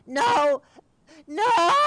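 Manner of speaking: very high, very loud shrieking no; doomed creature screaming at its master. Acted. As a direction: scream in denial.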